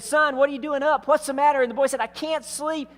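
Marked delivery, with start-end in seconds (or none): 0.0-1.6 startled dad. Acted. Dad woken by excited son. 2.0-2.9 put-on little boy. Boy answers his dad.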